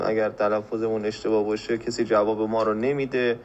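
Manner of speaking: usual speaking voice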